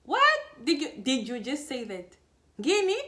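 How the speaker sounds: high, loud "What?" in utter disbelief; stunned, laughing outrage; shocked disbelief. Retold or imitated. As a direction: react like you can't believe your ears. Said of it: "started laughing out hysterically, like".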